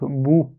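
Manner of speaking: "bou" with rounded, protruded lips; lips pushed forward. Shown as a demonstration. Teacher showing dhamma, "bou" with lips well rounded. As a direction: push both lips forward and close them for "bou". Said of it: "les deux lèvres, on les avance".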